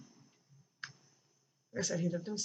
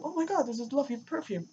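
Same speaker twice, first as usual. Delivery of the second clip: higher, gushing over the perfume; higher mock-gushing small talk to distract grandma; fake enthusiasm; 7 semitones higher, 4 dB louder than usual. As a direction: overplay delight to cover panic.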